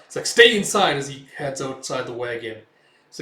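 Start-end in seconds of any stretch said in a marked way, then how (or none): 0.2-1.0 loud, raised warning; urgent order. Retold or imitated. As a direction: call out a sharp command. Urgent order as danger starts.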